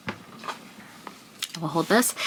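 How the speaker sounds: slow, hushed whisper. Real, not acted. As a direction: whisper it aside, very slowly. Hushed aside to the dog.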